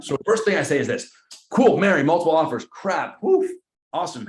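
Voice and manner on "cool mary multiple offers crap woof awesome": high, loud hammed-up "cool, Mary"; bubbly agent voice put on for the call; mock excited realtor. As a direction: fake enthusiasm while fishing for details. Realtor role-play reacting to listing agent.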